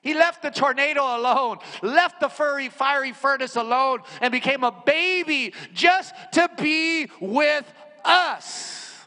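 loud, high building sermon; rising insistent preacher's voice; full-force preaching. Acted. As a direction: drive it home to the congregation.

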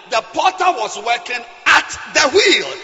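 loud fiery sermon; preaching. Acted. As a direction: preach it with full force. Preaching at full force.

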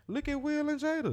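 teasing point. Acted. To his friend, teasing with a celebrity couple, high sing-song. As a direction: toss it out like a punchline.

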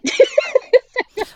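a sudden high burst of laughter; real laugh. Real, not acted. Laughing out loud at her own retold quote to her cohost.

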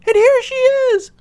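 tiny little squeak, very high and loud; loud, squeaky cub cry; Baby Bear. A narrator's reading. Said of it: "squeaked in his tiny little voice".

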